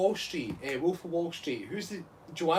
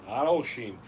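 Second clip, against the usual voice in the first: ordinary delivery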